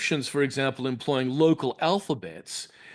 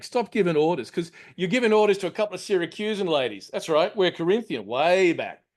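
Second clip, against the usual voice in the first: higher, brisk broad Australian accent; 4 semitones higher than usual. Shown as a demonstration. Lecturer reading the translation to the audience, in a broad Australian accent. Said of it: "a fairly broad accent".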